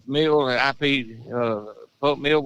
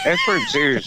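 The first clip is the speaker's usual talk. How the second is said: laughing. Real, not acted. Laughing at lost Cub Scout.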